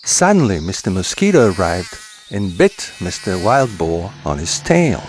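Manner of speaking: ordinary delivery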